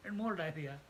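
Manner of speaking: high-pitched, protesting voice for the mind; mock refusal from an unruly mind; the mind talking back. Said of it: "this fellow says".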